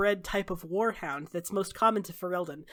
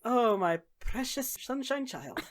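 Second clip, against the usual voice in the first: slow, slightly raised, doting voice; a hint of indulgent affection, drawn out; lightly voiced fond Zevran; 4 semitones higher, slower than usual. Zevran answering a companion's sarcasm, slowly doting.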